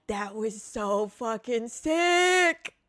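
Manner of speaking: drawn-out, strained excited cry; thrilled. Retold or imitated. Kid telling friends after flying off the chair, thrilled. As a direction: stretch it out slowly, totally stoked. Retelling own thrilled reaction.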